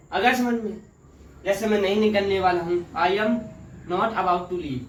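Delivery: ordinary delivery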